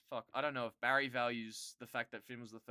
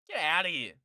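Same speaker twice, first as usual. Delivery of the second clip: louder get outta here; loud scoffing dismissal; dismissive yell; 3 semitones higher, 10 dB louder than usual. Real, not acted. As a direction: wave it off loudly.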